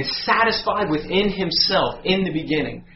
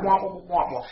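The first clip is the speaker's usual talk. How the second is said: higher, wordless droning adult; Charlie Brown's teacher; 3 semitones higher, 3 dB quieter than usual. Retold or imitated. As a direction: imitate a teacher's meaningless wah-wah drone. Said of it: "He sounded like Charlie Brown's teacher".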